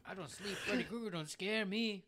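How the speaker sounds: high laughing mock bravado; hammed-up fearless boast through giggles; fake tough guy swagger.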